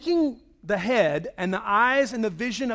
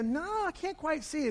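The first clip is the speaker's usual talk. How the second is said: unseeing friend; 7 semitones higher, 7 dB quieter than usual. Retold or imitated. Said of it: "They go".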